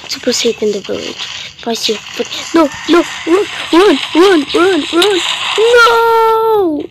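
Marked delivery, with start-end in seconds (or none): 2.6-5.2 high, loud run run; panicked urging. Real, not acted. Fleeing the dinosaur. 5.6-6.8 high, loud anguished cry; crying shout. Real, not acted. Dinosaur catches him.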